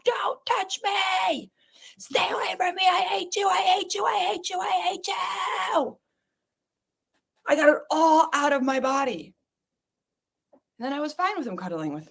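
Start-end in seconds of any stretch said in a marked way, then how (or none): 0.1-6.0 retold scream. Wife re-enacting screaming at husband, high mock scream. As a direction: hurl it at him as if he were there. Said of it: "I screamed like he was in this room".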